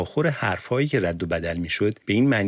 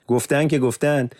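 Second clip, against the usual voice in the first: high, loud furious protest; sharp retort; 8 semitones higher, 4 dB louder than usual. Acted. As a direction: fire back at the interrogator, rising into desperation. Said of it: "یوسف به تندی پاسخ داد".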